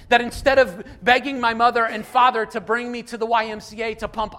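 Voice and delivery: loud, driving sermon voice; a preacher building up steam; preaching at full force. Acted. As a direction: preach it hard to the room.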